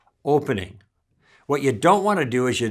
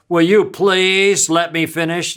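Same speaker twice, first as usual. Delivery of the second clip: louder, slow, gruff demand; mean and gruff; 4 semitones higher, 6 dB louder than usual. Shown as a demonstration. To a steamroller, mean gruff demand. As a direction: bark it out like a fed-up boss. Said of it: "being really mean and gruff".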